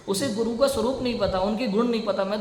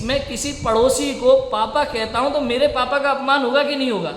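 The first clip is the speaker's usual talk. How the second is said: raised, insistent sermon voice; impassioned preaching; 5 semitones higher, 5 dB louder than usual. Acted. Preacher defending scripture to his audience, impassioned. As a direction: drive each point home to the hall.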